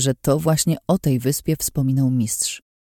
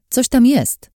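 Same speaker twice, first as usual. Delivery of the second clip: eager discovery; 10 semitones higher, 5 dB louder, slower than usual. Acted. Vicky discovers something hidden.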